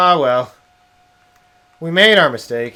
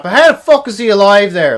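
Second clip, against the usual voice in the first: loud, high, still fuming; angry outburst; 6 semitones higher, 8 dB louder than usual. Real, not acted. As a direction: bark the question out in frustration. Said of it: "Sorry I got angry at that".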